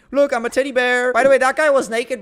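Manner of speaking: mock-cute, high announcement; playful teddy bear. Player shows off the suit he took, playful teddy bear.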